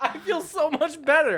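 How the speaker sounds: high, loud words through laughter; laughing. Real, not acted. Guest hearing the host's worse accent, laughing high. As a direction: crack up with relief.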